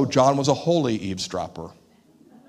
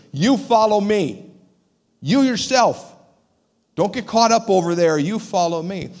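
stern rebuke; 5 semitones higher, 5 dB louder than usual. Retold or imitated. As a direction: press each "You" hard. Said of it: "why such a stern rebuke".

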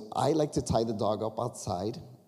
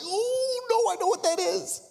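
high, sudden cry of dread; high-pitched panicked oh no; horrified realization; 4 dB louder than usual. Retold or imitated. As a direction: gasp it out, knowing it's bad. Hearing the thunk of the dog.

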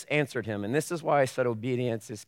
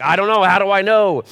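mock flustered, higher and louder; louder, higher mock panic; confused listener; 6 semitones higher, 10 dB louder than usual. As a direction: play the baffled churchgoer.

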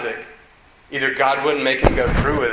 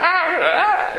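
much higher comic shrieks; hammed-up high-pitched cries; mock scream; slower than usual. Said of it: "And then I, like".

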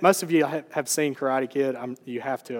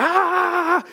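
sudden shout; 4 dB louder than usual. Retold or imitated. Teacher attacks, student cries out.

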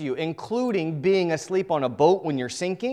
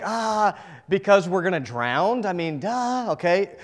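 louder, higher mock disciples scoffing; sarcastic duh; 5 semitones higher, 5 dB louder than usual. Disciples answering Jesus, mock sarcastic duh. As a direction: play the disciples rolling their eyes at the obvious. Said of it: "The disciples are like".

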